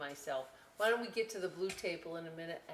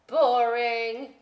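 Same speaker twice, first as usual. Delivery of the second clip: loud drawn-out whine of boredom; 4 semitones higher, 13 dB louder than usual. Acted. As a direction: moan it like a bored potato. Potato voice in read-aloud book.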